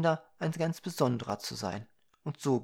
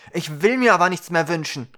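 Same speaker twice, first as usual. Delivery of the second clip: loud, raised, obstinate refusal; dwarvish stubbornness; 4 semitones higher, 10 dB louder than usual. A narrator's reading. Dwarf refusing the Ukra another wish, stubborn and loud. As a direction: dig the heels in, loudly. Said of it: "mit typisch zwergischer Sturheit".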